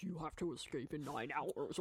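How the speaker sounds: a half-hearted attempt at a distorted captor; voice changer voice. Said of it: "that's supposed to be my voice changer voice".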